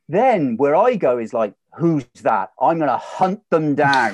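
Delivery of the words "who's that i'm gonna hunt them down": mock rage. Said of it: "this huge rage and frustration".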